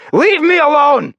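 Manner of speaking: very high, loud, stretched-out scream; desperate shout. Acted. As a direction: scream to be left alone. Rebelling against his captor.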